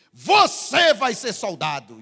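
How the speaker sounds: loud, barked commander's order; imitated commander's loud pronouncement; army commander. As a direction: bark it at a recruit. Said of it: "cara feia daquele comandante".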